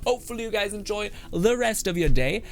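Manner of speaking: host outro, ending on a high note. Acted. High-pitched sign-off outro.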